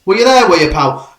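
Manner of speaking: loud, high challenge to a caller; hammed-up radio phone-in question; mock phone-in exchange. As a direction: pitch it up and push the question. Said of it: "you get someone ringing up and go".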